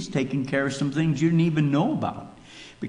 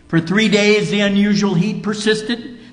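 high and loud, driving the story home; preaching at full force; 5 semitones higher, 5 dB louder than usual. Acted. Preacher telling the congregation about the prayer day, full force. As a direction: build it like a revival preacher. Preacher builds suspense loudly.

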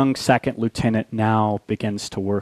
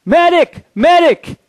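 high, loud battlefield yell; desperate shout for help; crying out for a medic; 9 dB louder than usual. Retold or imitated. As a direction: scream for a medic under fire. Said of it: "cries out".

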